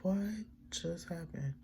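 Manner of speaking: high, hushed disbelief; small high bewildered voice; confused. Retold or imitated. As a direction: ask softly in baffled disbelief. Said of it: "I was so confused".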